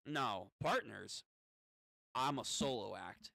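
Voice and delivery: slow, low character voice; low refusal. Acted. Kid refusing the demon's partnership, low and slow.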